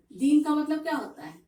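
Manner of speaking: ordinary talk, nothing marked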